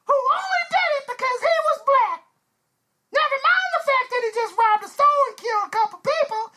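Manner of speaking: mocking, very high-pitched whine; hammed-up squeaky protester voice. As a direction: complain in a high, indignant caricature.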